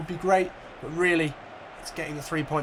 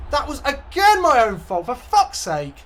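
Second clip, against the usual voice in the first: loud, higher, furious at himself; angry self-blame; 9 semitones higher, 9 dB louder than usual. Real, not acted. Gamer cursing his own defensive mistake, angry. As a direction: berate yourself for the error.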